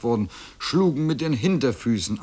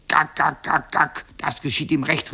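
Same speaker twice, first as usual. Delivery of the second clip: staccato, higher hen cackle; clucking hens; 4 semitones higher than usual. A narrator's reading. Hens jeering at the shrunken boy, clucking. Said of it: "riefen die Hühner".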